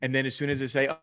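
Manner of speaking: usual speaking voice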